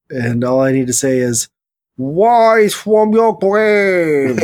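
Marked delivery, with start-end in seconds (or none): 2.0-4.4 slow, high, stretched delivery; dramatic drawn-out voice. Pretend. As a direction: drag every word out theatrically. Mock dramatic answer, very slow.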